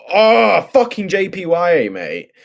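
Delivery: loud, high-pitched burst of frustration; exasperated outburst. Real, not acted. Trader fed up with the J P Y chart, exasperated. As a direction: groan it out loudly, then curse the chart.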